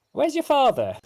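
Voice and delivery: high, pointed demand; sharp mother's question. Acted. Halfling mother asking her sons where their father is, sharp and high.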